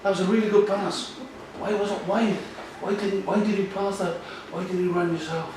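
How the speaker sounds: encouraging coach's questions, lightly and high; coach questioning. Shown as a demonstration. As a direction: gently quiz a young player, voice lifted and light. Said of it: "Ask questions after".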